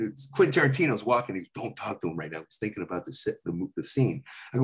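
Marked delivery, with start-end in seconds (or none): 1.5-4.2 low, soft hushed caution; hushed warning. Retold or imitated. Friend warning the teller on set, low and hushed. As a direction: keep it down, don't disturb the director.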